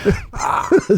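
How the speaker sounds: high-pitched burst of laughter. Real, not acted. Guest laughing at his own mascot story, high laugh.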